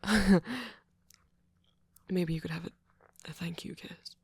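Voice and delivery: hesitant, stammering speech with long pauses; shy, flustered date. Acted. Flustered host welcoming a date to movie night, shy and halting. Acted shy roleplay, then plain outro.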